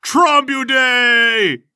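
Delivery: high, loud triumphant shout; shouted verdict. Acted. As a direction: throw up your hands and yell it.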